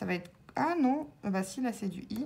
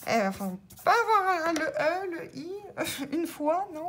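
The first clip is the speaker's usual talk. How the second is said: exasperated; 7 semitones higher than usual. Real, not acted. Frustrated at missing vowels again.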